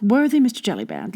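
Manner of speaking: usual speaking voice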